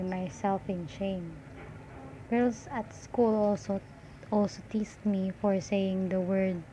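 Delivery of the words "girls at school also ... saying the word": ordinary talk, nothing marked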